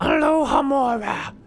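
high, loud spell-casting voice; ringing high character voice; magic incantation. Acted. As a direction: proclaim the password like an incantation. Professor speaks the magic password.

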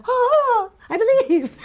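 high, gasping mock revelation; mock awe. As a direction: act out a sudden conversion, laughing. Acting out Ruby's awed conversion.

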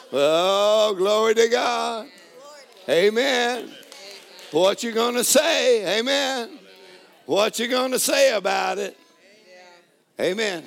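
loud, high, slow preaching over music; full-force preaching. Acted. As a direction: preach it out like a revival climax.

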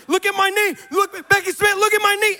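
high, loud hysterical squeal; mock tearful amazement. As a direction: imitate an overwhelmed girl crying out. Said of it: "And she starts crying. She's like".

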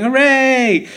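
high, loud little hooray; mock cheer. Parents cheering that there are no kids' jokes, a mock cheer. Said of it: "and then the parents going".

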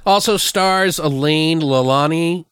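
ordinary talk

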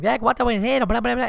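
high, loud mock shouting; imitated yelling. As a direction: mimic people yelling across the house. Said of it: "people yelling".

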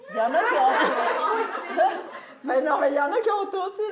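high and loud through laughter; laughing gush. Real, not acted. Raving to the audience about Marco, laughing high and loud.

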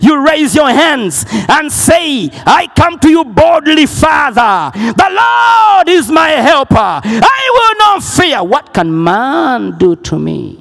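ringing bold prayer; full-force preaching. Real, not acted. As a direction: boldly declare it to the church.

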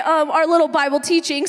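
normal talking voice

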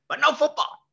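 high, stern repeat of the warning, the word no hammered; hammed-up warning from a strict mother; mock stern Tita. Mother warns her son off football, high and insistent. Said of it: "she said".

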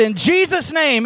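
re-enacted bold prayer ending; prayer closing. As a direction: proclaim it to seal the prayer.

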